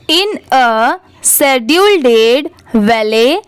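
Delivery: very slow, word-by-word reading; teacher demonstrating slow reading aloud; careful model reading. Shown as a demonstration. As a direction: read it out slowly for learners, pausing between words. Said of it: "एक बार अच्छे से read करके देखते हैं".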